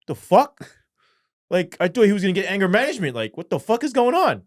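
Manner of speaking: high, loud mock astonishment; loud, incredulous retold reaction; stunned outburst. Retells shocked DA and probation officers.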